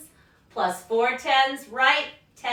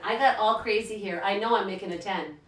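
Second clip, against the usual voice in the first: ordinary delivery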